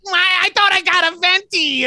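high, whiny mock customer complaint. Mocking an entitled coffee customer, high and whiny. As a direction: pipe it up like an entitled customer whining.

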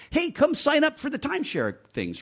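high, sing-song salesman pitch; timeshare hawker. Retold or imitated. Retelling the timeshare guy at the arena, high mock salesman voice. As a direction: mimic a pushy street promoter. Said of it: "They're like".

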